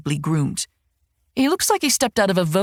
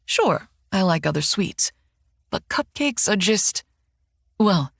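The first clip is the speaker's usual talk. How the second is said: plain reading voice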